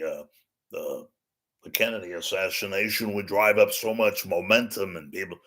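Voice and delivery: Johnny Carson. Acted. Host telling his sidekick he was unaware, halting impression. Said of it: "let's just talk to Johnny".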